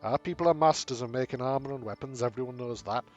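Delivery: gruff-proud dwarf craftsman, lightly voiced; dwarf bragging about his people's armor. Dwarf recounts his clan's craft.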